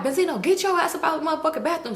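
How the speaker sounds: high, rapid mock scolding; angry order. Uncle wants his bathroom cleared, quick mock-angry order.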